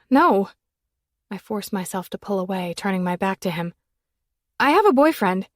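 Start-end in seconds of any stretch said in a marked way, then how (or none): 0.1-0.5 blurted denial, higher and louder; panicked loud refusal; sharp loud no. A narrator's reading. Denying the feeling sharply. 4.6-5.5 higher, louder protest; flustered raised voice; defensive outburst. A narrator's reading. Pulling away, turning her back.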